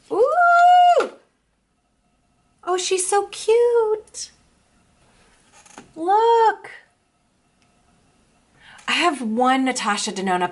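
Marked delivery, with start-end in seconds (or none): 0.1-1.1 a loud, high squeal of delight; excited squeal. Real, not acted. First look at new palette. 2.6-6.7 high, slow cooing over something adorable; gushing delight. Real, not acted.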